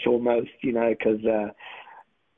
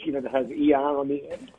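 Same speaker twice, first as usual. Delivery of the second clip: normal talking voice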